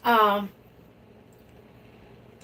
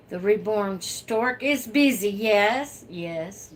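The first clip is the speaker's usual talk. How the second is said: usual speaking voice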